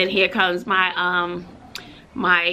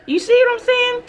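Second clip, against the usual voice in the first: emphatic high; 5 dB louder than usual. Real, not acted. Emphatic appeal to viewers.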